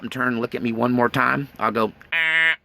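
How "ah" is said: short sharp deer call sound. Shown as a demonstration. Hunter calling to a deer, short call. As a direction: call out to stop a fleeing deer. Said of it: "That's deer talk".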